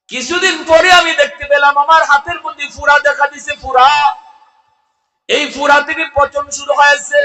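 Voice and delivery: high, loud sermon declamation; impassioned high-pitched preacher's chant; full-force preaching. Acted. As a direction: ring it out to a big congregation, stretching the last word.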